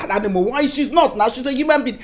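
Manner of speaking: ordinary delivery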